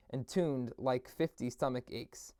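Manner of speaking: ordinary delivery, nothing marked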